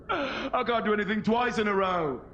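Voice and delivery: ordinary delivery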